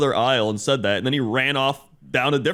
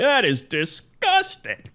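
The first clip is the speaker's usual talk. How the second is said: put-on old lady disgust; 10 semitones higher, slower than usual. Old lady's retort in story.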